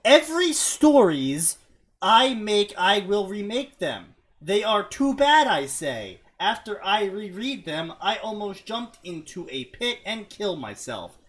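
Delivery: high, loud, emphatic fan fiction read; mock-dramatic reading. Reading bad fan fiction aloud to friends, mock-dramatic. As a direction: declaim it in big swoops of pitch.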